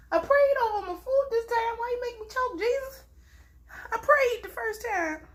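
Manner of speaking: high, softened plea to Jesus; wounded, pleading complaint; plaintive complaint. Real, not acted. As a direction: protest high and small, like an injured party. Mock complaint to Jesus after choking.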